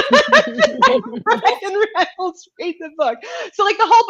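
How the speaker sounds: laughing hard through the words; words squeezed out between laughs. Real, not acted. Laughing through her own story.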